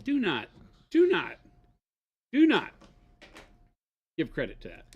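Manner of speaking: escalating shout of do not; rising loud protest. Real, not acted. Scolding co-host over chat.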